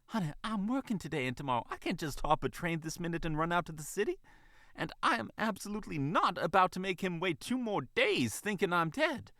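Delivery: high, fretful Southern-style mother voice; huffy fox mother. Acted. Fox mother objecting to the bunny, huffy and crestfallen. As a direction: protest in a high voice, pitching up on the refusal. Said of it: "Vivian huffed softly".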